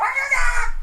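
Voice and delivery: high, loud furious little creature scream; angry Ewok shriek. Acted. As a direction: shriek with tiny rage. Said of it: "the other one's really mad".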